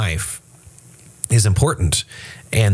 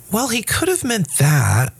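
higher, hedging and noncommittal; evasive pastor voice; 6 semitones higher than usual. Retold or imitated. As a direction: dodge like a slippery preacher. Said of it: "it's this kind of like".

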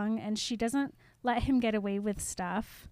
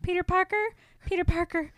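lightly imitated film heroine, pitched higher; Kirsten Dunst; 6 semitones higher, much faster than usual. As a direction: repeat the name in a higher movie-character voice, easing down. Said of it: "Kirsten Dunst saying".

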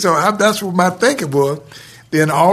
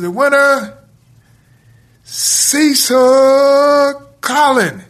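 drawn-out announcer call, higher and louder; ring announcer call; 9 semitones higher, 5 dB louder, much slower than usual. Retold or imitated. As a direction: stretch the winner's name like a ring announcer. Announcer declaring fight winner.

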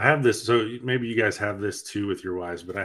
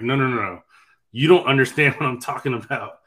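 louder, laughing protest; retold protest; 4 semitones higher, 7 dB louder than usual. To wife about cards, mock insistent laughing protest. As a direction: brush her off with a laugh. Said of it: "And I'm like".

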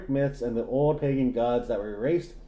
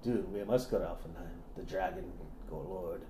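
viking; 6 semitones lower, 12 dB quieter than usual. Pretend. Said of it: "we're just doing Skyrim".

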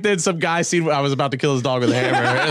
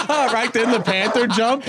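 ordinary talk, nothing marked

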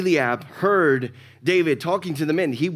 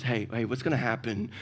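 hushed; 5 semitones lower, 5 dB quieter than usual. Retold or imitated.

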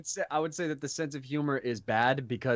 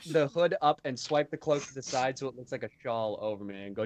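ordinary talk

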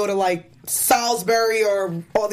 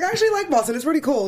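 imagined actor; 6 semitones higher than usual. Acted. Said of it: "He's like".

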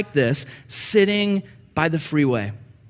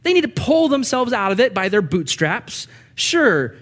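mocking objectors; 9 semitones higher, 4 dB louder than usual.

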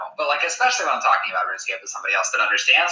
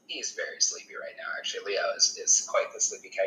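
hushed whisper, much softer; 8 semitones lower, 9 dB quieter than usual. Real, not acted. Host whispers about his sleeping cat, hushed whisper. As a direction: whisper so you don't wake the cat. Cat asleep next to him.

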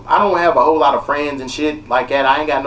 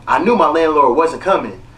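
ordinary delivery

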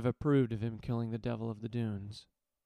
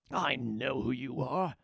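scowling. Acted. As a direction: spit it out with contempt. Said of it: "scowled the warlord".

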